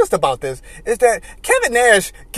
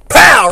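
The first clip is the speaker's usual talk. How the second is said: punch sound; 8 dB louder than usual. Retold or imitated.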